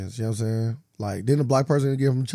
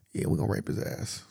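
mock sinister whisper; 5 semitones lower, 12 dB quieter than usual. Acting a prison threat.

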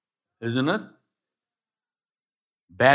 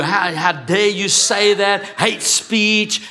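hammed-up offended protest, louder; mock outrage; 6 semitones higher, 6 dB louder, slower than usual. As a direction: imitate someone easily triggered. Mocking offended people.

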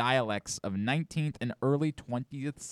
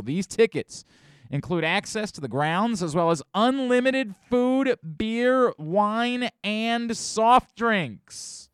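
higher-pitched, punchy pitchman; hyped promo read; 9 semitones higher, 5 dB louder, slower than usual. Acted. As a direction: sell the prizes big, drawing out the last item. Host hams up prize promo.

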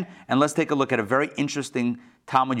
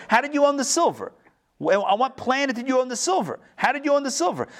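much higher, pressing disbelief; incredulous challenge; 9 semitones higher than usual. Acted. Teacher challenging the first owner, high incredulous demand. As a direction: demand an answer you know he can't give.

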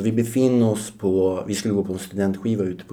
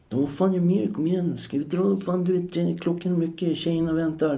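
much higher, pushy buddy voice; mocking the friends; 10 semitones higher than usual. Mimicking his friends urging him to leave, high-pitched. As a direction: squeak it like impatient mates hurrying him. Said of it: "du är så här".

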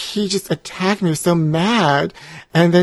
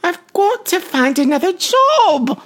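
higher, louder put-on voice; high-voiced job seeker; 9 semitones higher, 5 dB louder than usual. Ex-talk-show guest asking a fast food worker for a job, high put-on voice.

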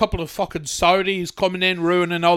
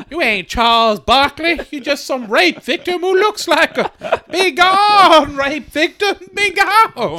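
much higher, louder, slower screech; shrieking mock voice; 11 semitones higher, 7 dB louder, slower than usual. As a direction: shriek it like a hammy diva.

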